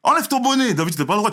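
high, loud mock teacher order; bossy teacher. Teacher ordering the boy to remove his hat, mock loud. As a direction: bark it like a fed-up teacher. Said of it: "le prof qui a dit d'enlever le bonnet".

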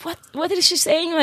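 lightly voiced, high-pitched bewildered English host; baffled host. Host asking the others about the student, mock bewilderment.

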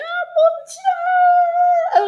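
high drawn-out shocked cry; a horrified cry of shock, stretched out; horrified gasp. Retold or imitated. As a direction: gasp in horror at the screen, slow and high. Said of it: "t'es comme ça, tu fais".

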